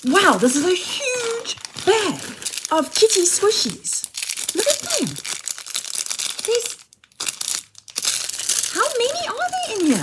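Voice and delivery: delighted high-pitched amazement; excited squeal. Real, not acted. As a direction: gush over the huge bag of squishies. Unboxing a huge bag.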